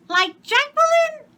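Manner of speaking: put-on very high, loud child voice; little girl's voice. A narrator's reading. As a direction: squeal like a small girl. Child asks.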